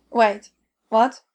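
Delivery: sudden loud startled question; surprised. A narrator's reading. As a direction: caught off guard, blurt it out. Audrey startled by the news.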